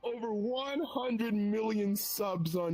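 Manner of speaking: high, slow, pompous celebrity voice; mock PewDiePie boast. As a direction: puff up like a self-important star. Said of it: "Felix is just there".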